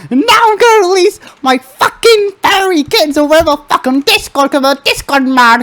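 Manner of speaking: put-on high, shouting furious kid voice; angry Timmy. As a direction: rant as a screaming enraged kid. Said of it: "Well, I'm Timmy".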